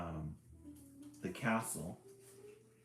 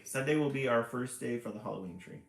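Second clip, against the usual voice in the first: plain reading voice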